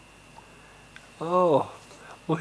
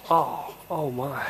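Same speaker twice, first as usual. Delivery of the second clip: ordinary talk, nothing marked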